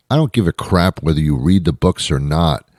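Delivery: usual speaking voice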